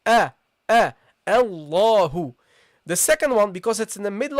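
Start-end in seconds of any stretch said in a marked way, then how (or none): 0.0-2.3 A a then a slow Allahu with the hamza sounded; hamza pronounced. Shown as a demonstration. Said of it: "pronounce it as a hamza".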